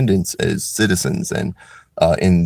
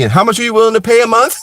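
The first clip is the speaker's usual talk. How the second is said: high, fast and louder, breaking into a laugh; mock clerk laughing; 13 semitones higher, 5 dB louder, faster than usual. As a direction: ask it while laughing at the absurdity.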